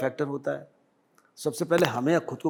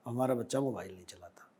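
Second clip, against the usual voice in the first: softer, breathless whisper of a grateful parent; hushed whisper; 4 semitones lower, 10 dB quieter than usual. Retold or imitated. Principal quotes grateful parents in a whisper. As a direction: drop to a whisper for the parents' words.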